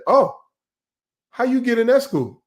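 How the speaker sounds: high-pitched incredulous question; mock surprise. Someone reading a person of color's resume, mock incredulous. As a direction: feign astonishment, pitched well up. Acting out doubtful resume reader.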